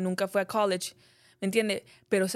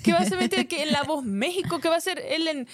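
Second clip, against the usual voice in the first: indignant disbelief; 7 semitones higher than usual. Real, not acted. Indignant rhetorical questions.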